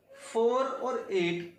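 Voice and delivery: Four and एट drawn out very slowly, word by word; teacher to students, reading the numbers very slowly. Shown as a demonstration.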